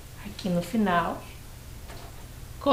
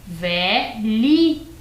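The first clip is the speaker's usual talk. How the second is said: "Velhinha" as VE, LHI, NHA, very slowly; three spaced syllables in "Velhinha", middle one palatal LH; 3 dB louder, much slower than usual. Shown as a demonstration. Teacher repeats the new word with H, syllable by syllable. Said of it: "Eu tenho a palavra".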